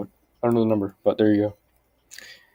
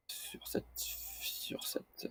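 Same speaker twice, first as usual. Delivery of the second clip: unvoiced whisper, barely audible; 21 dB quieter, much slower than usual. Real, not acted. Learner whispering to himself while sounding out French. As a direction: breathe the words without voice.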